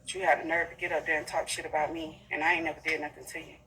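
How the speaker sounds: ordinary talk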